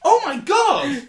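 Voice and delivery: very high, loud, laughing shout; shocked laughing shout. Real, not acted. Podcaster learning Andrew Garfield was in it, shouting. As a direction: blurt it out in real amazement. Continued amazement.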